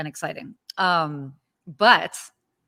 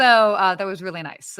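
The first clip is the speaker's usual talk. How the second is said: ordinary delivery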